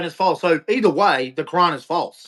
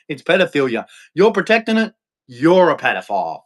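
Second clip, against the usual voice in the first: normal talking voice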